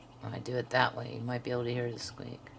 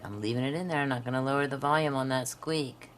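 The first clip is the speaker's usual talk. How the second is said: ordinary talk, nothing marked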